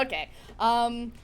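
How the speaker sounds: ordinary talk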